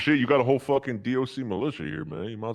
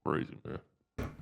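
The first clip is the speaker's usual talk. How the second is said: usual speaking voice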